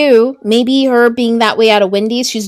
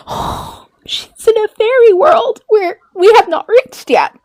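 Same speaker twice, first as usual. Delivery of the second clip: wistful awe; 12 semitones higher than usual. Real, not acted. Wistful about fairy world.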